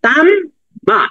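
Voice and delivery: "তাম", a clear pause, then "মা"; split syllables. Shown as a demonstration. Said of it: "মিমটা কয়বার উচ্চারণ হইলো". ("তাম" বলে থেমে আলাদা করে "মা")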